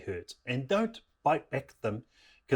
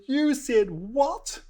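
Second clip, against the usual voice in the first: high, drawn-out incredulous question; hammed-up shock at a revelation; shocked disbelief; 14 semitones higher, 3 dB louder, much slower than usual. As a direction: stretch it out in squeaky outrage. Husband shocked at wife's secret.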